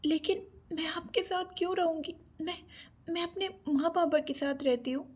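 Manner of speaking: put-on hesitant, mildly frightened girl's voice; afraid. A narrator's reading. Captive girl protests to her captor, afraid. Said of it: "Anjali ने डरते हुए कहा".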